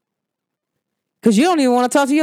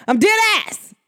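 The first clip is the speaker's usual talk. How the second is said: higher, emphatic New York accent; 9 semitones higher than usual. Shown as a demonstration. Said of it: "New York accent".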